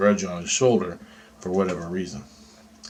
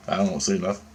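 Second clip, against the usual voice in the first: normal talking voice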